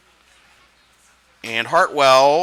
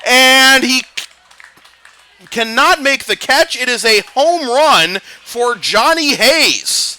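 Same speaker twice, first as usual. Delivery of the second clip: louder, higher, building to the big moment; home run call; 9 semitones higher, 6 dB louder than usual. Acted. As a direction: ride the ball to the wall.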